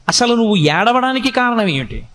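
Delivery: normal talking voice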